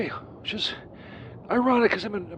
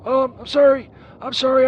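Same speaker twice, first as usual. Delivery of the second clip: loud, hurried sorry, caught off guard; flustered loud apology; 3 semitones higher, 8 dB louder than usual. Acted. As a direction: blurt out the apology in a panic. Apologizing after being cursed.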